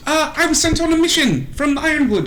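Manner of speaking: high, quick character voice; chirpy rider. Acted. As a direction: rattle off the report in a high, eager voice. Plays Terry explaining his mission.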